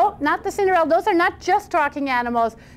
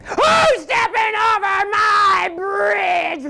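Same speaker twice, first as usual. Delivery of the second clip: slow, drawn-out roaring troll shout; high-pitched, stretched troll bellow; troll's roar; 7 semitones higher, much slower than usual. Acted. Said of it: "roared the troll".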